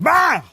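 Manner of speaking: punchy, high "bah" like a knockout blow; sudden loud blast. Acted. God puts Adam to sleep, sudden blast. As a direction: shout a sudden sound effect.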